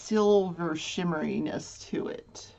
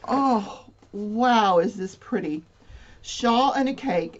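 ordinary talk

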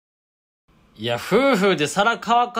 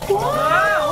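high shrieking wail of alarm; a sudden high scream; 5 dB louder, slower than usual. Real, not acted. Screaming at the plates.

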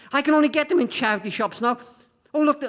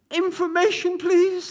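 put-on high, small child's voice calling; a nine-year-old reaching for help; little boy's voice; 9 semitones higher, 3 dB louder, slower than usual. Hurt boy calls the operator, high childlike voice.

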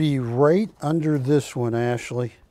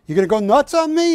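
higher and louder, teasing doubt; mock suspicious artist; 9 semitones higher, 5 dB louder, much faster than usual. Artist checking Barry won't go nuts, louder mock voice. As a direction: needle him playfully. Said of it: "I go".

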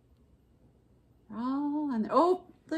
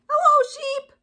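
cheery duck voice; 8 dB louder than usual. A narrator's reading. Duck calling out to Cow as he rides past, cheery duck voice. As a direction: call out high and loud as a little duck. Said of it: "said Duck".

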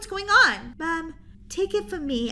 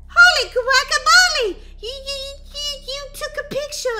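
high, loud cartoon-style astonishment; shocked exclamation; 10 semitones higher, 7 dB louder than usual. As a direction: squeal it, totally amazed. Stunned by zombie photo.

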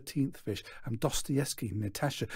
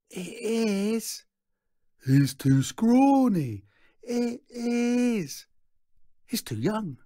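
high, drawn-out squabbling onlookers; squeaky to-and-fro crowd quibble; bickering crowd voices; 11 semitones higher, 5 dB louder, slower than usual. A narrator's reading. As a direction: stretch the words, pitched way up, disbelieving. Crowd argues back and forth.

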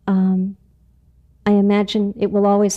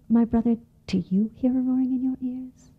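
a small secret whisper; whispered mouse; 3 semitones higher, 5 dB quieter than usual. Acted. Little mouse whispering to another brother. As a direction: breathe the question like a secret.

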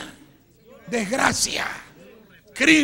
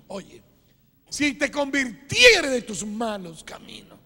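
ordinary talk, nothing marked

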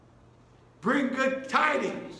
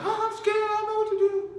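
mock scared whine; 8 semitones higher, faster than usual. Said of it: "people are like".

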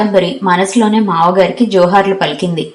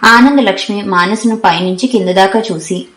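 plain reading voice

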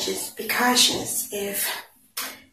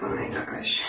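breathy embarrassed whisper; 7 dB quieter than usual. Real, not acted. Realizing her mistake, embarrassed whisper. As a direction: whisper it, feeling dumb. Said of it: "I felt, like, a little dumb".

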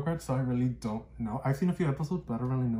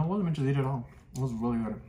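talking with mouth full behind bandana; muffled through cloth face covering; chewing chocolate; faster than usual. Real, not acted. Seen 1: feeds chocolate under bandana at 0-3.7s; mouth itself hidden.

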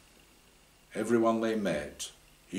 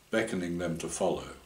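plain reading voice

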